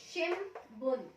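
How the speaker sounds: शिमबुन said slowly, the n turned to m before b; slow careful demo of शिमबुन; shimbun with m. Shown as a demonstration. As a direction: say शिमबुन as shim-bun, lips closed on m. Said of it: "तो अगर हम इसको pronounce करेंगे तो कैसे पढ़ेंगे".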